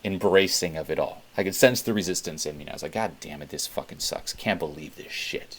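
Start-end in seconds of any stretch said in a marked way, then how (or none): 2.9-5.6 low muttered complaining under the breath; quiet annoyed inner voice; muttered inner grumble. Retold or imitated. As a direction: grumble it to yourself, half swallowed. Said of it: "there's that little inner dialogue".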